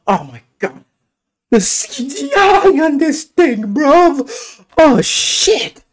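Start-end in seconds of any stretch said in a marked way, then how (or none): 1.5-5.8 high, loud burst of awe; awed excited shout. Real, not acted. Gamer awed by the dragon CGI, shouting high. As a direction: blurt it out, floored by the effects.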